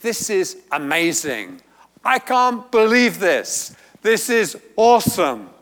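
high and loud, marvelling; amazed exclamation. Retold or imitated. Preacher voicing Matthew's amazement, high and loud. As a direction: shout it out in wonder. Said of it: "it's like saying".

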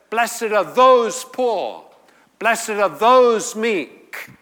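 slow booming blessing; emphatic Jesus. Retold or imitated. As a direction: point over the heads and declare it. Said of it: "And it's emphatic".

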